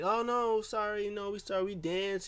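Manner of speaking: high-pitched mock apology; imitated apologetic Asuka. Asuka apologizing to Alexa, high mock apology. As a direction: pitch up, pleading and playful.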